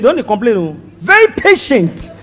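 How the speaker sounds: normal talking voice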